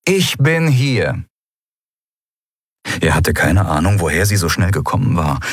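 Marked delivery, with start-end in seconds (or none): 0.1-1.2 very slow, drawn-out announcement; commandant's voice. A narrator's reading. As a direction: make an unhurried, commanding entrance. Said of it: "die tiefe Stimme seiner Kommandantin". (gedehnte Stimme einer Kommandantin)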